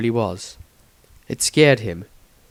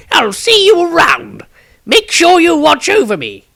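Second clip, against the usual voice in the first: put-on old wizard voice; 9 dB louder than usual. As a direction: lift the voice up high like an ancient wizard looking back. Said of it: "said the robed newcomer".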